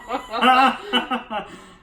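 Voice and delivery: loud giggling burst; burst of laughter. Real, not acted. Friends filming a question video, loud laughing burst.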